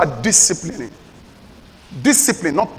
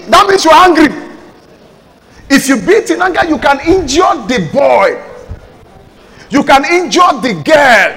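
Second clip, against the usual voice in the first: higher, louder thundering warning; preaching shout; 7 semitones higher, 5 dB louder than usual. Real, not acted. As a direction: hammer it at the parents.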